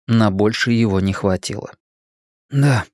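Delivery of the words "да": ordinary delivery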